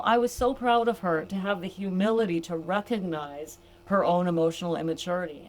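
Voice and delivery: normal talking voice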